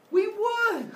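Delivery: high loud eager reply; high excited children. Retold or imitated. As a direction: answer like thrilled kids. Eager kids.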